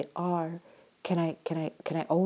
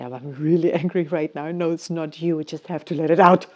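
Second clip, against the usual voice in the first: laughing admission; 9 dB louder, faster than usual. Retold or imitated. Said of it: "I say".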